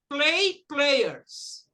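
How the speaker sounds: "Play players" bem articulado, modelo para repetir; model for repeating. Shown as a demonstration. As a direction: diga "Play players" claro, com força em "Play". ("Play players" pausado e nítido para a turma repetir)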